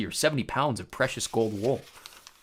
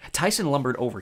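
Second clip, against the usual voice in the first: ordinary delivery, nothing marked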